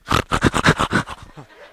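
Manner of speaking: mock snorts of a restless sleeper; noisy pretend snorting in rapid bursts; imitated sleep snorting. Preacher mimicking a sleeping spouse, imitated snorting. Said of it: "you're over there snorting".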